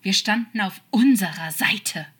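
loud, urgent insistence; slightly desperate hiss. A narrator's reading. Demon pleading their shared side, slightly desperate retort. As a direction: plead it forcefully, a little desperate. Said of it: "zischte er ihm zu, ein wenig verzweifelt".

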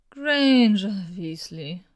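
drawn out very slowly, a hoarse stagey groan; milk the groan, as dramatic as a sore throat allows; theatrical groan. A narrator's reading. Draco groaning at Hermione from his chair, theatrical slow groan. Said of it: "stöhnte er, so theatralisch".

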